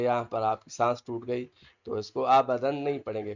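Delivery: usual speaking voice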